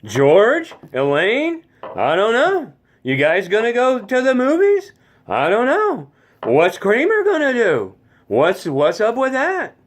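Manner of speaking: high, whiny stand-up cadence with stretched endings; Jerry Seinfeld impression. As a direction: do Jerry Seinfeld's singsong observational whine. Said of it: "Jerry Seinfeld has kind of that cadence".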